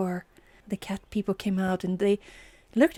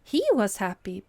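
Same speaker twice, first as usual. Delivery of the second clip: plain reading voice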